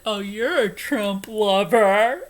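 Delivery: loud, slow sneering imitation; mocking the guy. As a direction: sneer it out like a jeer. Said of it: "The guy was like".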